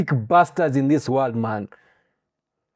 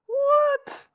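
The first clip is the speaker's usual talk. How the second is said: shocked what; 5 dB quieter than usual. Real, not acted. Stunned by the prison statistic.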